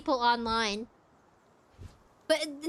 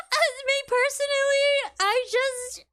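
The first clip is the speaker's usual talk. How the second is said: laughing; 9 semitones higher than usual. Real, not acted. Laughing through own remark.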